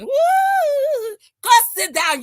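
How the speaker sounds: loud high mock wail; fake cry. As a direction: shriek a hammed-up fake sob. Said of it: "she will fake cry again".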